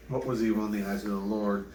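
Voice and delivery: ordinary delivery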